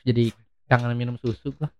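talking through a smile; soft, fond smiling tone. Real, not acted. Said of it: "kenapa ngomong sambil senyum-senyum".

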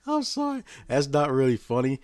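high, loud laughing apology; trying to speak through laughter. Real, not acted. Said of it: "but I'm laughing because".

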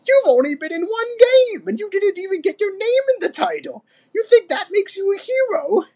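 very high-pitched mocking old ape; Cranky, scornful. A narrator's reading. Old ape ridiculing Diddy's hero hopes, scornful. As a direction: belittle him in a squeaky-high old voice. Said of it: "laughed Cranky".

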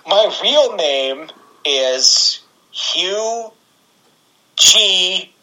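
slow, deliberate mock introduction with pauses; imitated deadpan self-introduction, word by word; straight-faced imitation. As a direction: announce a joke name in all earnest, drawn out. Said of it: "said it with a straight face".

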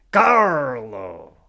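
loud pirate call. Pretend. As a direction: growl the name like a pirate. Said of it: "met een piraatstem".

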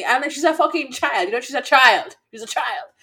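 high, loud retold outrage; very upset. Telling off a grown man over a child, upset protest. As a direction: protest indignantly, re-enacting the row. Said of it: "I was very upset".

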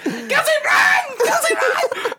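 very high, loud panicked shriek laughing through it; mock panic scream; screaming kid. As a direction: shriek it like a terrified little girl. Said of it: "she's like".

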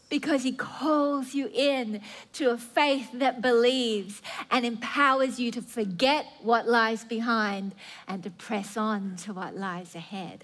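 ordinary talk

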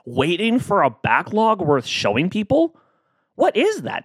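rising high disbelief; incredulous. Real, not acted. Host mocks his perfectionist excuse, incredulous. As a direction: scoff at the idea in rising pitch. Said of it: "It makes no sense".

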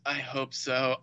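deadpan reply. Retold or imitated. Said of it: "Just his delivery of that was perfect".

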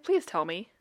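ordinary talk, nothing marked

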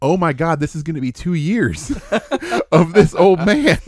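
loud, high, laughing exasperation; laughing mock dismay. Players facing two years of old man, laughing mock dismay.

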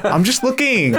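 high, laughing mock-nurse voice; put-on high nurse voice. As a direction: play the nurse in a high, giggly voice. Mock nurse voice, continuing the joke.

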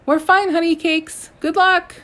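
high, loud, sugary goddess coo; doting hippo goddess. Acted. Taweret calls to the departing dwarf in a high loud doting coo.

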